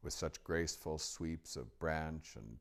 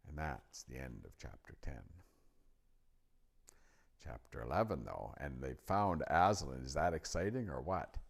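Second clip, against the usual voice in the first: plain reading voice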